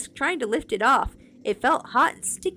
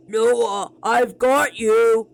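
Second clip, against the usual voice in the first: loud, slow monster shriek; deep raspy evil shriek; 8 dB louder, much slower than usual. Acted. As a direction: lunge at him with an evil rasp. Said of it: "shrieked, her voice deep, raspy, evil".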